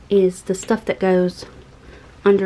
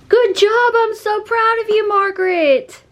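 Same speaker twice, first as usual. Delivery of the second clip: very high, excited pet-praise voice; gushing praise; 11 semitones higher than usual. Real, not acted. Owner proud of her cat Margaret, gushing high praise.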